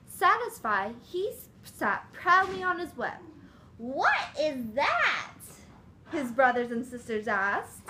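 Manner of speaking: lively storybook read-aloud; reading to children. Acted. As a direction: read it like a picture book to kids.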